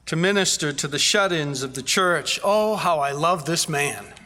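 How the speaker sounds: ordinary talk, nothing marked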